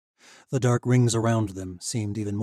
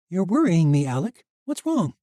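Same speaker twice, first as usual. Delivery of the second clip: worried; 9 semitones higher, 9 dB louder than usual. A narrator's reading. Misha alarmed.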